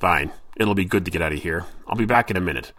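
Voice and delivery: gruff, relieved to leave; grumbling sailor. A narrator's reading. Smithy agrees and leaves.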